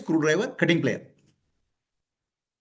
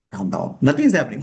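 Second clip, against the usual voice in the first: much lower, hushed calming; soothing; 12 semitones lower than usual. Acted. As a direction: settle a crying child gently. Soothing the crying ego.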